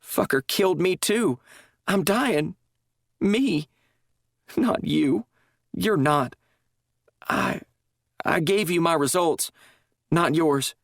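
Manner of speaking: high, halting, breaking voice; tearful confession. A narrator's reading. Drugged nurse confessing to chaplain, tearful halting voice. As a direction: confess between sobs, losing the thread.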